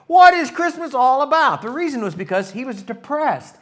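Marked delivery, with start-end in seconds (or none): 0.1-1.6 loud high desperate cry; hammed-up Charlie Brown yelling his question; shouting Charlie Brown. Pastor voices Charlie Brown screaming about Christmas, loud high cry.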